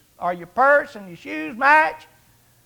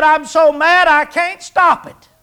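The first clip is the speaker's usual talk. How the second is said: mock angry excuse; 5 semitones higher, 4 dB louder than usual. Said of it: "two minutes ago you'd have said".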